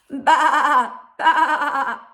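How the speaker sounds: high, loud wavering baa; sheep bleat. A narrator's reading. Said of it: "said the sheep".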